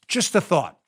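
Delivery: ordinary talk, nothing marked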